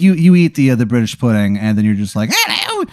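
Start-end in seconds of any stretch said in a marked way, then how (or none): 2.3-2.8 much higher, mock-posh English hello; hammed-up English accent; bad English accent. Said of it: "you have a bad English accent".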